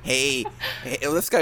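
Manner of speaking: ordinary talk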